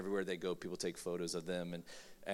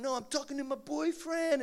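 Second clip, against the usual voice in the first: put-on whiny teen sibling; 4 dB louder than usual.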